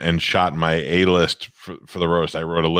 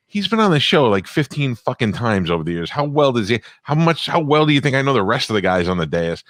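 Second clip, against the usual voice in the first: higher, indignant and worked up; indignant retort; 5 semitones higher than usual. Retold or imitated. To his agent about Nick's excuse, a raised indignant retort. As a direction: fire back in disbelief. Retold angry reply, pitched up.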